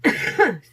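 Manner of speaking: loud, real cough fit; a sudden rough asthmatic cough. Real, not acted. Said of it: "Sorry, you guys".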